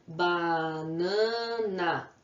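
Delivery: "Banana" very slow, ba, na, na; teacher's drawn-out three-beat "Banana"; syllable by syllable. Shown as a demonstration. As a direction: hold each syllable of "Banana" to count it. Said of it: "quantas vezes eu abro a boca".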